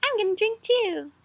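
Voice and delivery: put-on high small baby voice. Teacher voicing the baby in the picture, high and babyish. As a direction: squeak it like a tiny baby.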